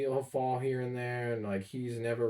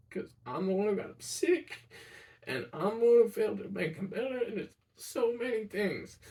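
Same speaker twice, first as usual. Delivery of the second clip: much higher, tight, close to tears throughout; voice breaking; 9 semitones higher than usual. Real, not acted. Grieving grandson recounting grandpa's aneurysm, voice cracking. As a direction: hold back tears as you tell it.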